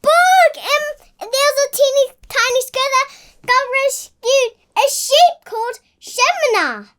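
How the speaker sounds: eager shout about her book; excited. Real, not acted. Child eagerly recalls her picture book.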